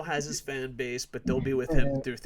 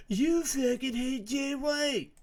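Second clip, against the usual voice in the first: higher, slow whiny mock voice; mocking critics; 11 semitones higher, slower than usual. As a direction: parrot the haters in a whiny drawl. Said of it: "people that are like".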